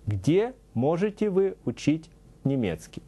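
normal talking voice

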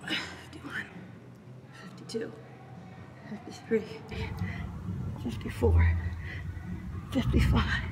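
breathless whispered counting; doing push ups. Real, not acted. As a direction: gasp out each rep count.

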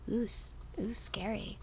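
breathy hushed ooh, scary; mock spooky whisper. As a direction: whisper it like a campfire ghost story. Mock spooky reaction to villain.

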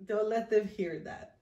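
ordinary talk, nothing marked

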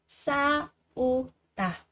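싸우다 slowly split as 싸-우-다; syllable by syllable. Shown as a demonstration. Teacher drilling learners on 싸우다, slow and separated.

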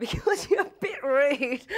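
slow laughing words. Real, not acted. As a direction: laugh through the line. Laughing while quoting director.

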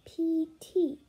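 ordinary delivery, nothing marked

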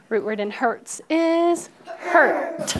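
"Root word in hurts is hurt" said slowly and clearly, leaning on "is"; slow modelled answer. Shown as a demonstration. Teacher modelling the answer for the class, slow and deliberate. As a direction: spell out the answer slowly for students to echo. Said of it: "get your choppers out".

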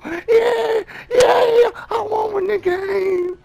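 high, loud crying cheers; overjoyed home-run scream with tears; tearful victory shouting. Real, not acted. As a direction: scream it out, half sobbing. Walk-off home run celebration.

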